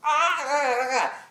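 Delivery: mock toddler protest; imitated kid squeals. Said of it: "the kids' response were".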